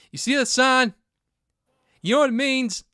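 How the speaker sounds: loud, high-pitched imitation of a ranting father; screaming dad impression. Host imitating the dad scolding his kid, hammed-up screaming. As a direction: yell it like an overacting movie dad. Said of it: "he's just screaming".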